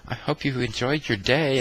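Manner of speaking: high-pitched farewell; young hostess. Retold or imitated. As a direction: imitate a young girl at the front desk. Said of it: "the young girl at the front goes".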